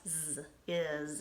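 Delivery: "z, is" with the S buzzed as Z; buzzing z sound. Shown as a demonstration. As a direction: buzz the "z" in "is". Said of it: "S sounds like a Z".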